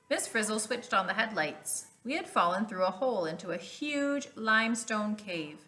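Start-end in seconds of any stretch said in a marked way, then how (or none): none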